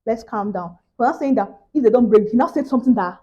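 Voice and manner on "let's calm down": soft, slow calming words; calming hush. Retold or imitated. Hushing her friend Wale to calm down, softer and slower. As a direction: soothe a friend quietly. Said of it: "I was still saying to Wale".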